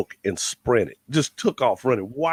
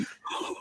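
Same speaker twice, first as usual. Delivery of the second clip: a sharp mock gasp of horror; mock gasp at witnessing on homecoming; shocked gasp; 13 dB quieter than usual. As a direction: suck the air in, scandalized.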